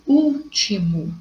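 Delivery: teacher sounding out "último", stressing ÚL; stress on first syllable. Shown as a demonstration. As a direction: stretch out ÚL in "último". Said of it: "terceira sílaba".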